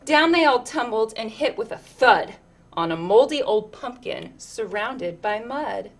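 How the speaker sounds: ordinary delivery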